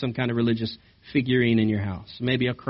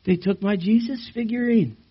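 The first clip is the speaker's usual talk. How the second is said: much higher, whining complaint; mock whiny believer; 10 semitones higher than usual. A churchgoer moaning about his stolen figurine, mock whining. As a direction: whine like a spoiled churchgoer. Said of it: "Do you say, "Oh".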